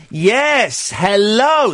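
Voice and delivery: slow, over-enunciated tourist English; mock British tourist. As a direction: talk down slowly to a foreigner. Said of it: "the bloody idiot doesn't understand me".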